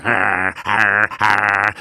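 loud growled har-har; teeth-baring snarl. A narrator's reading. Little savages menacing the mammoth, teeth-baring snarl. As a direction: growl it through bared teeth. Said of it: "sie fletschten die Zähne".

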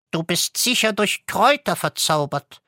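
put-on high, lilting goose girl. A narrator's reading. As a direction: speak up high as the enchanted goose. Goose explains enchantment.

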